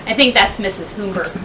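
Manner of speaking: plain reading voice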